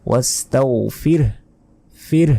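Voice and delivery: ওয়াস্তাগফির with still h after ফির; ওয়াস্তাগফির ending in a resting h; correct pronunciation. Shown as a demonstration. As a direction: stop on a silent হ after ফির. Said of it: "তখন উচ্চারণ হবে".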